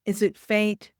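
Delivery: ordinary delivery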